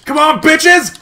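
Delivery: shout. Acted. Rallying teammates, shouted louder.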